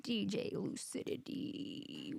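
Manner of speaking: DJ hype voice. Pretend. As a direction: announce it like a DJ. Dreamy DJ name announcement.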